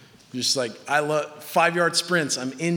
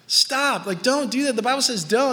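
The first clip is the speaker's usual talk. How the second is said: high-pitched, insistent exhortation; emphatic calling-out; urgent correction; 7 semitones higher than usual. Acted. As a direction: plead firmly at raised pitch. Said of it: "speaks into your life and says".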